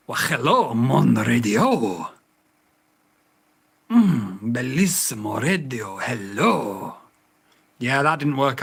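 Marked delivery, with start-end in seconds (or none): 0.1-2.1 lower, slow put-on French accent; a hammy French accent to charm the radio; mock French accent, drawn out. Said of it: "speaking of French accent". 3.9-6.9 playful fake Italian accent. Trying accents on voice control.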